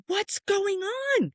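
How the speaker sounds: very high, slow mock scream; retold scream. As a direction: imitate the friend's panicked scream as she bursts in. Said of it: "And she goes".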